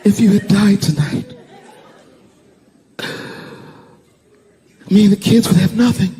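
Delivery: high, loud tearful wail; imitated sobbing wife. Wife crying to her husband over nearly losing him, mock sobbing. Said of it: "like, sobbing".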